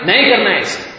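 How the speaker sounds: mild parental telling-off; lightly acted stern parent; acted scolding. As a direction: scold a child firmly, without shouting. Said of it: "بچوں کو آپ ڈانٹ رہے".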